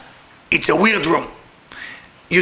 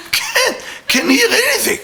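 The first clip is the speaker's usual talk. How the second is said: high-pitched comic complaint; can't-hear-you squeal; mock straining to hear; 14 semitones higher than usual. Acting out straining to hear over music.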